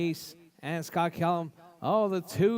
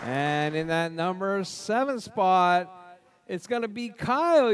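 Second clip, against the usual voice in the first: loud, drawn-out lineup call with shouted names; starting grid announcer; 3 semitones higher, 5 dB louder, slower than usual. Real, not acted. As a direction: boom each driver's name out over the track.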